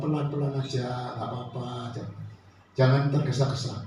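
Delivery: gentle urging. Retold or imitated. Passenger to a speeding taxi driver, gently urging. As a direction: quietly coax someone to ease off.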